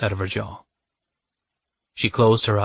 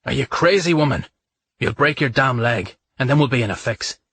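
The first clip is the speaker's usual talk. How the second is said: raised, angry scolding, higher and louder; 4 semitones higher, 4 dB louder than usual. A narrator's reading. Man scolds the woman for risking a fall, angry. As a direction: snap at her for risking her neck. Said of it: "His voice rose in anger".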